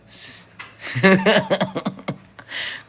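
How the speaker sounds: high loud burst of laughter; big high giggling laugh. Real, not acted. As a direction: crack up at your own joke. Cracks up at own joke.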